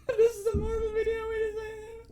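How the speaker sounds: words squeezed out high through helpless laughter; can barely talk for laughing; laugh-crying. Real, not acted. Laughing so hard at his cohost he can barely speak.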